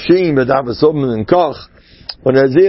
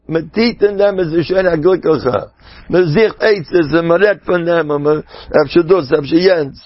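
usual speaking voice

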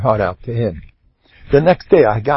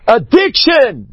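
loud, high, drawn-out "Addiction!"; alarmed exclamation; 13 semitones higher, 5 dB louder, slower than usual. Shown as a demonstration. Preacher acts the panic at hearing the word, loud and high. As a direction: blurt it like a frightening word.

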